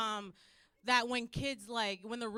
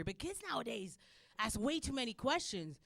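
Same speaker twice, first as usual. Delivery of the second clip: ordinary talk, nothing marked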